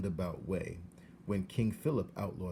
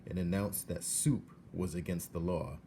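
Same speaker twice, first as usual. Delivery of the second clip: usual speaking voice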